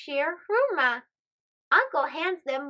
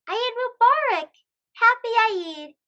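excited greeting; 7 semitones higher, 5 dB louder than usual. Acted. Kids greet after prayer.

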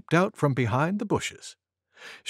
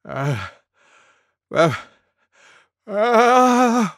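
gasps growing higher and louder; suspenseful "ah... ah... ahh"; sneeze build-up; 8 semitones higher, 6 dB louder, much slower than usual. Acted. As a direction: build toward a pretend sneeze. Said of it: "went Elephant".

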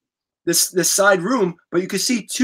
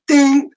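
high, loud ding sound; elevator ding; 8 semitones higher, 6 dB louder than usual. Retold or imitated. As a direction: chime it out like an elevator. Elevator arrives in retold story.

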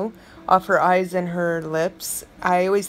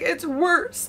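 mock grandmother; 10 semitones higher than usual. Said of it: "She's like".

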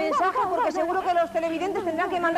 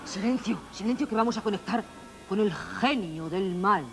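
spooky witch voice; 8 semitones lower, 3 dB quieter than usual. Acted. Invoking spirits, spooky voice.

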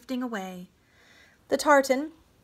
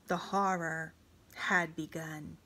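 usual speaking voice

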